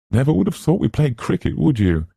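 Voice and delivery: high mock schoolboy voice; mocking imitation. A narrator's reading. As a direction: parody the boastful master. Luke mocking Arthur.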